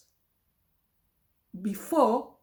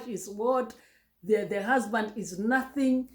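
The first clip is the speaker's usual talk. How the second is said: ordinary talk, nothing marked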